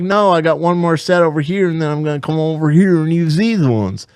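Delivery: lightly imitated Southern gym-goer; a touch of Southern twang; Southern accent. Gym-goer answering about the machine, Southern accent. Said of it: "that's how he sounded".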